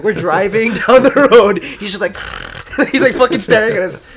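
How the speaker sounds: cracking up while telling it; giggling loudly mid-story; laughing. Real, not acted.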